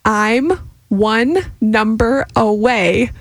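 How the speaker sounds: very slow, exaggerated lip moves. Shown as a demonstration. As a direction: drag every word out. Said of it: "Slower with your lips".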